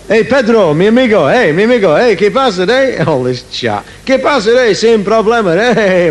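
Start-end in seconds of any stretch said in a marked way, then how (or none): 0.1-3.0 higher, loud hammy Spanish-style greeting; hammed-up fake Spanish bravado; mock Spanish accent. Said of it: "Spanish, kraut, Italian garbage". 4.0-6.1 higher, loud hammy Spanish-style greeting; hammed-up fake Spanish bravado; mock Spanish accent. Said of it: "Spanish, kraut, Italian garbage".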